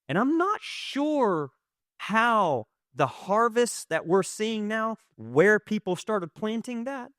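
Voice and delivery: urgent pulpit call to the room; rising preacher. Acted. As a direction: lift it up and preach it slowly. Preaching to the congregation.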